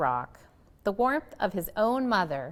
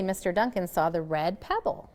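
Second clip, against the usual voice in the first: plain reading voice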